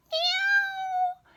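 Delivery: very high meow; cat meow imitation. Shown as a demonstration. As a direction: mew like a little cat. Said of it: "bet you can do cat".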